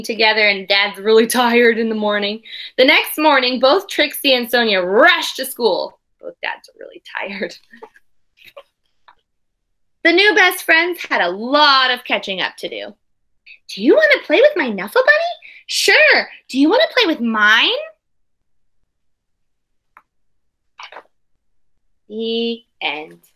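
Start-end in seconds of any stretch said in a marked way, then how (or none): 2.8-13.0 put-on expressive read-aloud for children; storybook reading voice; story narration. A narrator's reading. Teacher reads picture book aloud. 13.7-16.3 put-on high, loud, quick kid voices; two new best friends chattering at school; eager little girls. Girls swap offers in play. 16.5-23.2 put-on expressive read-aloud for children; storybook reading voice; story narration. A narrator's reading. Teacher reads picture book aloud.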